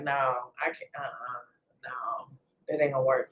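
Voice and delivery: low, hushed, half-whispered refusal; weary refusal. Retold or imitated. Tired parent declining football practice, muttered low refusal. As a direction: mumble it to yourself, barely voiced. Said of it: "So I was like".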